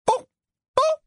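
tearful giving in. A narrator's reading. Mother yields, nearly crying.

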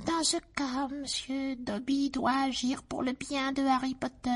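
high squeaky elf voice; shrill little creature, insistent; house-elf voice. Acted. Dobby speaks despairing.

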